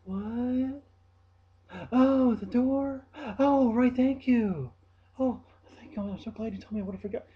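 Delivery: high, loud slow airhead gasp; clueless coworker thanking for a reminder, squeaky mock voice; mimicking coworker. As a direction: play her dopey overthanking. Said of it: "she'll say".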